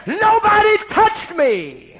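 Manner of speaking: high, loud, slow denial; high insistent cry. Retold or imitated. Wife denying the vicar's claim, high slow insistent cry. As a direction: insist on it, stretched out.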